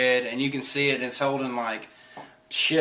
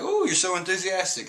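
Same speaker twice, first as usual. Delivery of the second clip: higher, flattered tone; pleased Nami; 7 semitones higher than usual. Retold or imitated. Nami flattered by the crew's interest, higher voice. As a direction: sound touched by their interest. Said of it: "she's like".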